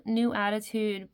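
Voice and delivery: usual speaking voice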